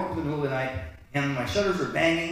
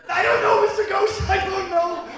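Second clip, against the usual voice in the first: very high, loud panicked cry; terrified wail; 12 semitones higher, 8 dB louder than usual. Retold or imitated. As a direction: cry out in fright to a ghost. Terrified answer to the ghost.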